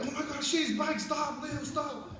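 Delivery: high, urgent mock alarm; panicked. Kids begging Leo to stop the bus, panicked. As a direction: imitate a frantic plea to stop. Retells the panic at the crushed bike.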